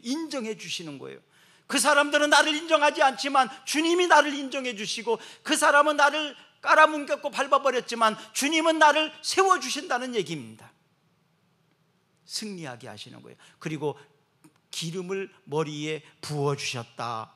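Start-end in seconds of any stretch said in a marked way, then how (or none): 1.7-10.7 higher, louder triumphant declaration; fiery sermon climax; preaching at full force. Acted. 14.7-17.3 slow, deliberate verse reading; solemn recitation; slow scripture reading. Acted.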